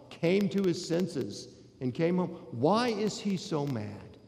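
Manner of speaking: ordinary talk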